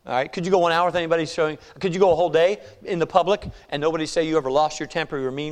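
ordinary talk

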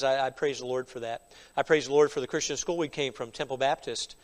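ordinary delivery

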